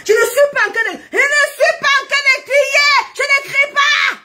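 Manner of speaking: high yell, rising as it repeats; cri d'exaspération. Real, not acted. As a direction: shout that you are not shouting. Yells while denying she is yelling. (protestation criée, très aiguë)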